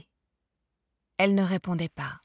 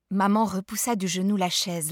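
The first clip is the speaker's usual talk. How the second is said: ordinary delivery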